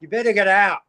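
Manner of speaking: mock yell across the camp; retold yell. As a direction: call out a half-hearted retold warning. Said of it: "this bloke yells out".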